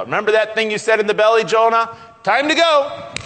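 high, loud call building to the end; acted summons. Preacher voicing God sending Jonah to Nineveh, acted summons. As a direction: call Jonah out to his job.

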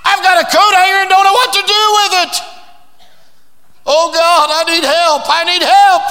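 high, loud, fast panicked plea; frantic mother begging for help; desperate woman. Acted. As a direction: cry out like a woman at her wits' end. Preacher acting desperate woman.

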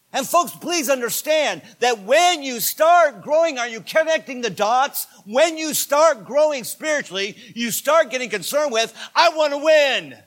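loud, high, impassioned sermon shout; full-force preaching. Real, not acted. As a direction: build a rallying cry, hammering each declaration.

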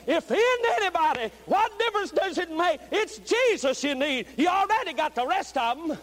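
high, loud, driving sermon shout; impassioned revival preacher crying out; full-force preaching. Real, not acted. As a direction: preach at the top of your voice, building to a cry.

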